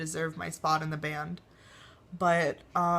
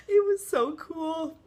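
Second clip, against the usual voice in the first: real sobbing, much higher, slow; crying; 10 semitones higher, 4 dB louder, slower than usual. Real, not acted. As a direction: squeeze the words out through tears. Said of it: "I was bawling my eyes out".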